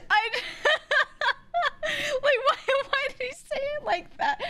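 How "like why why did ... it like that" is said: very high, squeaking through laughter; cracking up while talking; real helpless laughter. Real, not acted. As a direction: barely get the words out for laughing. Cracking up over the replayed clip.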